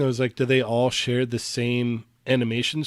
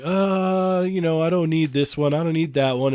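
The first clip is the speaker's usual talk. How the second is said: higher, dismissive self-parody; mock reluctance; 5 semitones higher, 4 dB louder than usual. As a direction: imitate yourself waving it all off. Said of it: "I'm like".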